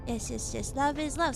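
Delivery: ordinary talk, nothing marked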